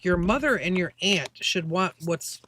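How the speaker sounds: normal talking voice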